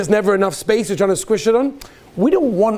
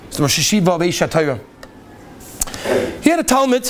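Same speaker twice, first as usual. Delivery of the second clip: usual speaking voice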